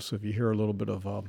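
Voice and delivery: ordinary talk, nothing marked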